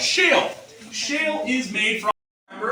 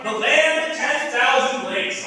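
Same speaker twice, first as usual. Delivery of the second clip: ordinary talk, nothing marked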